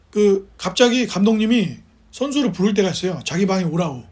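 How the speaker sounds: usual speaking voice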